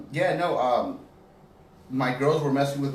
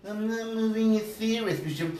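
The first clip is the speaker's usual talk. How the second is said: higher, slower meek Norbit; timid Norbit pleading; 9 semitones higher than usual. Pretend. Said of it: "Do the voice".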